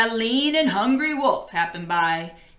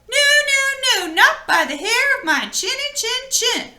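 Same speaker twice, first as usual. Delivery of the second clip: squeaky little pig; 12 semitones higher than usual. Acted. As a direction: squeal it like a tiny panicked pig. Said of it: "the little pig replied".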